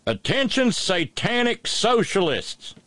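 high, slow hammed-up yokel drawl; mock dialect reading. Host mocks a viral door sign in a hammed-up Southern dialect. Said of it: "so I'm gonna read it in dialect".

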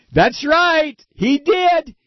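high, loud sarcastic outburst; punchy high-pitched cry of disbelief; mock-amazed exclamation. Real, not acted. Incredulous aside on Libby's thanks.

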